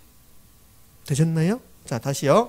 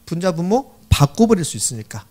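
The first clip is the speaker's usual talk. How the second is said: usual speaking voice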